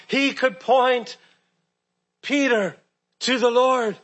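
ordinary talk, nothing marked

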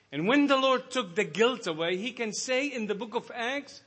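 high, loud, ringing sermon voice; full-force preaching. Acted. Preacher urging the brethren, high and loud. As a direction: preach it out, climbing with fervor.

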